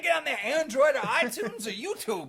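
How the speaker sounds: high, loud and laughing; playful high-pitched scolding; laughing mock insult. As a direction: bark it at him through laughter.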